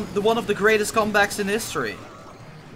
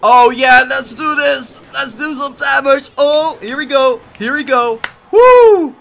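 loud excited cheering; pumped gamer hype; hyped up; 6 semitones higher, 9 dB louder than usual. Real, not acted. Hyped attack push.

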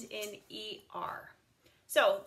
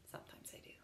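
whispered aside; 20 dB quieter, much faster than usual. Real, not acted. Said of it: "aside after never eat candy".

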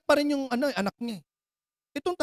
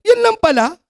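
much higher, louder astonished outburst; shocked disbelief; 8 semitones higher, 9 dB louder than usual. Acted. Father stunned at the small question, loud disbelief. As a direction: burst out in disbelief. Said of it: "Nagulat eh".

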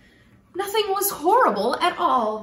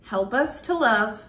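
put-on children's prayer; 5 semitones lower than usual.

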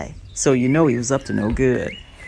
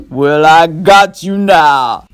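slow, louder gloat, catching breath; gloating fox; 8 dB louder, slower than usual. Acted. Burr Fox gloating at the stuck rabbit, slow and loud. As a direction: savor it slowly. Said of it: "when he was able to catch his breath".

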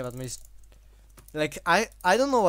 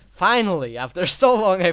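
loud relief; 5 semitones higher, 12 dB louder than usual. Real, not acted. Reacts to finally losing.

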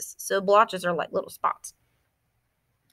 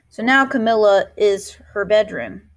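plain reading voice

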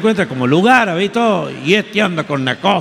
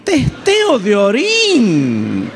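"Testeo de orín" very slow, higher, stunned; incredulous repeat; 5 semitones higher, much slower than usual. Retold or imitated. Father repeating his daughter's request, very slow and stunned. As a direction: drag out "Testeo de orín" in disbelief.

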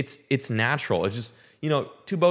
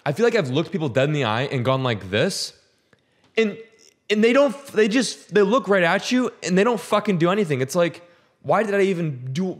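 worked-up rant; 7 semitones higher than usual. Real, not acted. Ranting about ignored waves.

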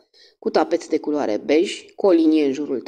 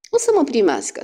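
firm, assured and a little higher; authoritative; 8 semitones higher, 3 dB louder than usual. Acted. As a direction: state it as settled fact. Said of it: "Autoritatea din tonul său".

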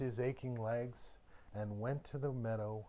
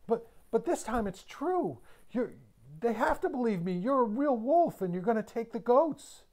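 put-on pleading boy; 10 semitones higher, 6 dB louder than usual. A narrator's reading. Frightened boy pleads with wolf.

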